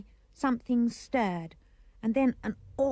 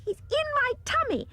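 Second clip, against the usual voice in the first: put-on excited child; 13 semitones higher than usual. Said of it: "I cried".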